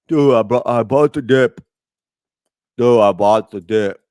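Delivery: loud bro voice, bragging; crypto bro. Pretend. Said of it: "Do I sound like a crypto bro?".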